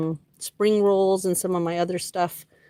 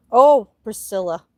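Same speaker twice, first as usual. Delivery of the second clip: sudden recall; 2 semitones higher, 9 dB louder than usual. Real, not acted. Suddenly remembers plant's name.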